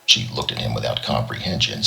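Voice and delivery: usual speaking voice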